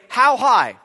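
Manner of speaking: an eager listener instantly obeying a thunderous voice; eager loud reply. Retold or imitated. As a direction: shoot back the answer loudly. Voice from heaven says jump.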